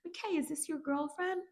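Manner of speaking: high-pitched put-on voice, rising at the end; squeaky caricature of a nosy greeting; mock nosy question. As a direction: imitate prying small talk, way up high. Acts out Cal questioning McKay.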